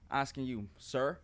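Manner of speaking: ordinary talk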